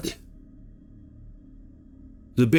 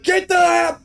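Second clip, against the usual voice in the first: high, loud angry yell; furious swearing; 4 dB louder than usual. Retold or imitated. Chris swearing at the bear, furious shout. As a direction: bellow a curse at the animal. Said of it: "he's swearing up a storm".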